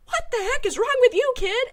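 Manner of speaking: high, loud, baffled mom; mom's over-the-top retold outburst; mock exasperated yell. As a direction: throw up your hands at the kid, half-joking. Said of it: "And I was like".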